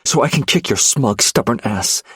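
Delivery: high, loud defiant snap; angry retort. Acted. Wife held tight, snapping back at husband. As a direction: spit it back at him. Fighting in his arms.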